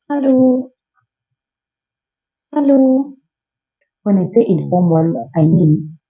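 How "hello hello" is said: high, sing-song hello; casual hello. Pretend. Greeting a friend informally with a high sing-song hello. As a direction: call out to a friend. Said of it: "You can say".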